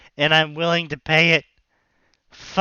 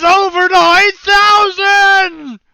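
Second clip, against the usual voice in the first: meme shout; 14 semitones higher, 11 dB louder than usual. Retold or imitated. DBZ meme quote shouted.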